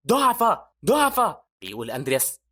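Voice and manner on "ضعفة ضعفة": high, loud taunting chant; strange voice. A narrator's reading. Strange voice heard by Andreas, loud repeated taunt. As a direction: chant it loudly as an eerie voice. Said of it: "صوت غريب عمال يقول".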